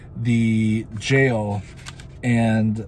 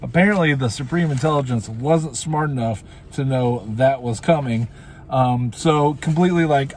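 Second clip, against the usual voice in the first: ordinary delivery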